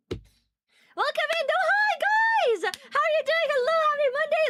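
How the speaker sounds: high, drawn-out welcome; excited greeting. Real, not acted. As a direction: stretch the hello out happily. Greeting chat, slow and high.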